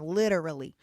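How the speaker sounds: LITERALLY with an American flap; flapped T. Shown as a demonstration. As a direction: punch out LITERALLY as "lidderally". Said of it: "tongue goes back and hits the roof".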